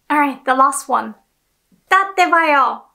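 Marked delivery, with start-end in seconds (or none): 1.9-2.8 lightly voiced anime catchphrase, higher and louder; Naruto. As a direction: toss out a hint of an excited anime hero. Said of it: "So this is from Naruto".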